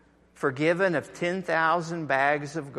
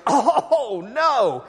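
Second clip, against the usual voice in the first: high, loud mock groan of dismay; dismayed guy; 4 semitones higher, 5 dB louder than usual. A man hearing seventy times seven, mock dismay. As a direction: whine it like a put-upon brother. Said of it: "And the guy goes".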